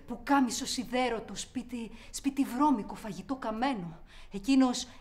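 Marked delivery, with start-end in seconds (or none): 4.3-4.9 loud sarcastic rant; loud scorn at the perfect family; bitter outburst. Acted. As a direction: spit out the fake perfection.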